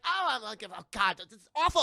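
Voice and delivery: mock dismayed girl. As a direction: whine in horror as the food-covered student. Acts out Kitty covered in food.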